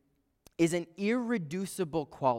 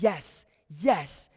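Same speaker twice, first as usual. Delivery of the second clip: retold excited yeses, pitched up and louder; 2 semitones higher, 5 dB louder than usual. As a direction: exclaim it like a thrilled listener cheering someone on. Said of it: "I was so excited".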